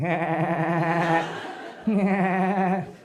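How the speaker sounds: rapid staccato ha-ha-ha; imitated rat-a-tat laughter; machine gun laugh. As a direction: rattle off a laugh like gunfire. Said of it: "machine gun laugh".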